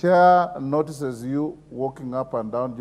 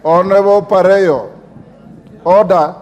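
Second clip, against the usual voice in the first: loud, stern call to order; 3 semitones higher, 8 dB louder than usual. Real, not acted. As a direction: call the member sharply to order. Speaker calls member to order.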